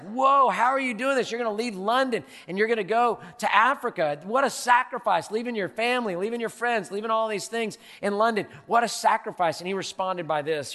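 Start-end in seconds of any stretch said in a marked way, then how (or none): 0.0-9.6 mock-astonished crowd, raised voice; amazed onlookers. Onlookers marvel at Livingston leaving London, loud and amazed. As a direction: play the awed friends gasping at his choice. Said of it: "everybody's like".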